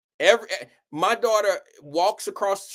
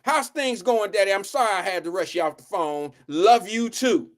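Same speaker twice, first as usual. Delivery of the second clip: sugary daughter voice; 3 semitones higher than usual. Retold or imitated. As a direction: play the doting daughter she is not. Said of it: "She don't text me".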